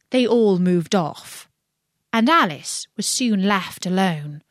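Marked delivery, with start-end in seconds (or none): none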